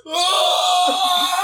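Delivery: high, loud powering-up yell; Super Saiyan scream. Retold or imitated. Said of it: "do this Super Saiyan scream".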